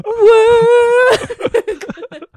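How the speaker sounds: high wailing cry; high mock sob after the husband's jab. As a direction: let out a half-laughing wail. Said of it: "搁那儿哭".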